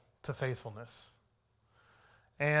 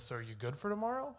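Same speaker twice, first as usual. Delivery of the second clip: teasing boss; 6 semitones higher, 8 dB quieter, faster than usual. Retold or imitated. Teasing boss question, quoted.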